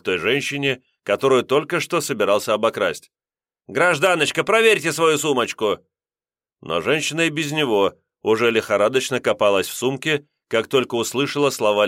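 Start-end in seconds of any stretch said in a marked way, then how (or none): 3.7-5.8 выше, громко на публику; нарочито позвать. A narrator's reading. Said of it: "громко обратился". (вор громко обращается к пассажирке)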